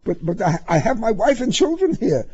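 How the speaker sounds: high, stammering, frightened driver; nervous high voice, tripping over words; flustered protest. A narrator's reading. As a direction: plead timidly with the officer.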